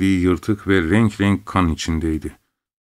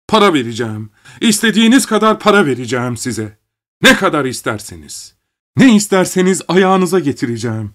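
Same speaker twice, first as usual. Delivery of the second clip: higher and louder, begging; frantic pleading; 7 semitones higher, 3 dB louder than usual. A narrator's reading. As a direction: plead desperately for his daughter. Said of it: "Çırpınarak şöyle diyordu". (çırpınarak yalvaran yüksek ses)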